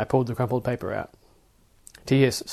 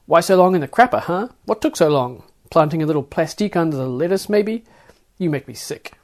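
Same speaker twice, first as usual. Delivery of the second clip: loud, mocking accusation; sneering guard; 5 semitones higher, 7 dB louder than usual. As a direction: TS mocks the passenger's long bathroom visit, loud and sneering. TS sneering at the passenger.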